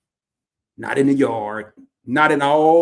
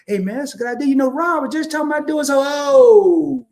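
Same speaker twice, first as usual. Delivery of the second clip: excited blurting; 12 semitones higher, 7 dB louder than usual. Acted. Friend excitedly blurting out the secret idea, high and rising. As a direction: blurt it out, rising higher and louder.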